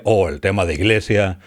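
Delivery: ordinary talk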